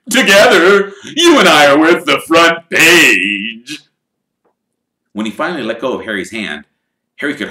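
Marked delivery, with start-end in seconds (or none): 0.1-3.8 showman voice. Acted. Lockhart voiced throughout.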